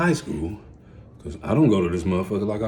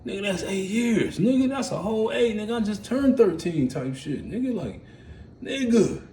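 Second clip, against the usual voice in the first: much higher, stunned and incredulous; a kid reeling from his sentence; disbelief; 11 semitones higher than usual. Retold or imitated. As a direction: pitch up in shocked disbelief. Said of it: "I'm like".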